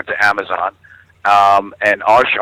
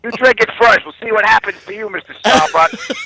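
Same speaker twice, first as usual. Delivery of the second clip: higher, mock-suspicious challenge, slowing at the end; suspicious New Yorker; 10 semitones higher than usual. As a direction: dare him to drink it first. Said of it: "It's like".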